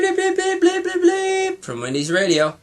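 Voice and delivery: high electronic beeping; radio bleeps. Acted. The radio signals again with high bleeps. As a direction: beep like a little machine. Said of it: "heard the familiar bleep".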